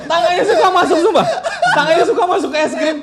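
high, loud laughing telling. Real, not acted. Shopkeeper telling friends about kids' hands, laughing. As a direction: tell it cracking up. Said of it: "laughing retelling".

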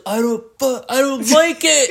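high, loud whiny outburst; acted upset protest. Upset man protests the pointing jar, high loud outburst.

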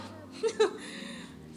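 breathy squeal of a laugh, very high; embarrassed high giggle. Real, not acted. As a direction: giggle high at your own blunder. Laughs at her own slip.

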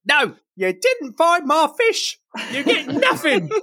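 high, slow scolding cook; Tamale voice. Acted. GM as Tamale refusing the chips, high and slow. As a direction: deny him the fish, high and slow.

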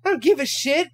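high, loud indignant shouting; high-pitched furious rant at the stepmom; retold yell. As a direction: yell it back in a high, outraged voice. Yelling back at stepmom in story.

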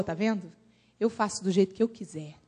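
low, soft voice of God; God speaking. Retold or imitated. God answering the doubting man, low and soft. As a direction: quietly assert divine authority, low and understated. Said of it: "Aí Deus pegou o cara e falou".

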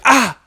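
high, loud yelp; showing listeners the yelp from touching something hot; mock burn yelp. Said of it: "you'll be like".

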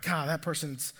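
low, quiet grumble of irritation; privately fed up, low and soft; judgmental grumble. Acted. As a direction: grumble it under your breath, judging the neighbor. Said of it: "this judgmental attitude".

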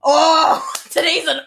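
high, loud, delighted squeal; gleeful shout. Retold or imitated. Person thrilled to hear Maya has covid, shrieking high. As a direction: shriek with joy at bad news.

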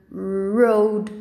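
"road" with a held English R; English initial R. Shown as a demonstration. Teacher gives an R example, English initial R. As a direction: say "road" as RROAD, not HOAD. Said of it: "E quando começa com R, a gente fala".

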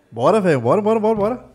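high, eager bora bora; hyped calling out. Real, not acted. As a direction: call him in, all hyped up. Hyping up the arriving courier.

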